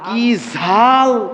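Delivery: drawn-out mock cry at hot curry; slow, stretched mock exclamation of heat; imitating someone burned by spice; complaining about spicy food. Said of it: "তরকারিতে বেশি ঝাল হলে".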